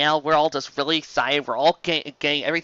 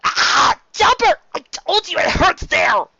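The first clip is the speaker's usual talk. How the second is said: loud high cry of pain, then complaint; pained yell; 13 semitones higher, 8 dB louder, slower than usual. Acted. Luffy yelling at Chopper for pressing his belly, pained shout. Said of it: "was calling to yell".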